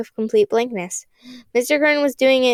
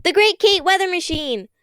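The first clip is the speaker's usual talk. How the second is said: higher, louder shouted name; loud proud shout; 8 semitones higher than usual. Acted. Kate shouts her own name to resist, high and loud. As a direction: announce it at the top of your voice. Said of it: "Hansen shouted".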